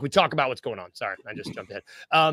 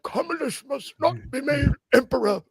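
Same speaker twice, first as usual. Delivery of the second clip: a higher, lightly voiced old statesman; old man quote; 8 semitones higher than usual. Telling cohost his favorite Gladiator moment, a light old man voice. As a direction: lightly imitate the solemn old senator. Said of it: "old guy performances when he's like".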